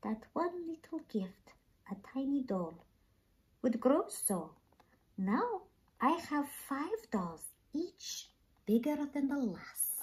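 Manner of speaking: put-on a girl whispering to her cat. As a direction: hush it to the cat in wonder. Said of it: "she whispered to Lady Mouser".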